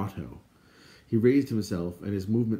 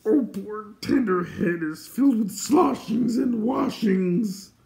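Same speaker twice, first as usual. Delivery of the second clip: high, slow, loud wail; drawn-out high-pitched lament; sputtering creature; 13 semitones higher, 5 dB louder, slower than usual. Acted. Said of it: "sputtering shadow rolled toward him".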